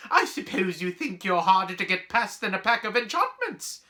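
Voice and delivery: high, loud scolding outburst; storming. Acted. Professor losing her temper at pupils, storming. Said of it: "she stormed".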